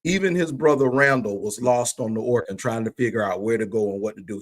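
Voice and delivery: normal talking voice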